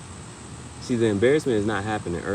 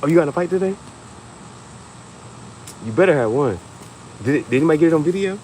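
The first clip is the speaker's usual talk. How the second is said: hyping up; 7 semitones higher, faster than usual. Pretend. Parent in the car hyping kid about the fight. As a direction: pump the kid up with excitement. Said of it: "I'm gonna be hyping my kids up".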